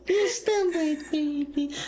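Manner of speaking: very high, slow cooing; doting grandparent. Shown as a demonstration. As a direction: fuss over the baby, way up high. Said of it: "Give me your best grandma impersonation".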